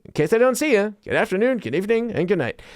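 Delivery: high, cheery sing-song sign-off, lightly imitated; a hint of a chipper neighbour's greeting; Jim Carrey. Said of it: "him from, like, The Truman Show".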